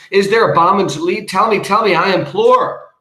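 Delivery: high, loud, urgent imploring; impassioned plea. Acted. Speaker demands answers from the raven, high and loud. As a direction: cry out to the bird for an answer. Pleading to the bird.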